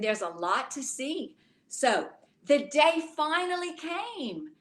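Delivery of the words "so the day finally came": put-on rhythmic rhyming read-aloud for children; storybook reading. A narrator's reading. Teacher reading a rhyming book to children, gently. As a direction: read the rhymes to the little ones.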